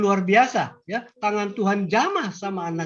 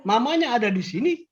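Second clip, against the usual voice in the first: ordinary talk, nothing marked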